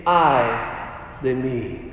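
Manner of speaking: low, hushed, broken by a pause; solemn woe. Acted. Preacher voicing Isaiah cursing himself before God, solemn woe. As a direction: let it sink low and soft, word by word.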